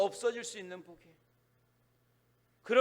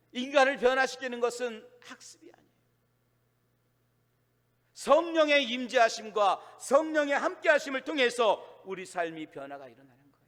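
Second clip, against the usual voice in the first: forceful preaching; 3 semitones higher, 8 dB louder than usual. Acted. Preacher telling congregation the Spirit changes lives, forceful preaching.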